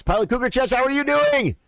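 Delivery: high-pitched, singled-out hello rising to a long peak; excited greeting. Real, not acted. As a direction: greet them in an exaggeratedly high voice. Playfully greeting a recognized chat member.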